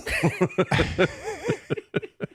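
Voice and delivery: a high, giddy burst of laughter. Real, not acted. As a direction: let the laugh spill out after the shock. Laughing after the surprise.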